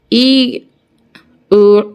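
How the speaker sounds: ordinary delivery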